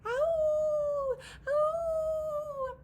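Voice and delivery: long high loud wolf howls. Acted. The wolf pack howling across the cliffs, loud and high. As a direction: howl it to the cliffs. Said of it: "started to howl".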